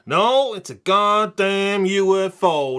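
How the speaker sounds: slow, loud, high exclamation. Acted. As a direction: bellow it out slowly. Punchline of mock opening.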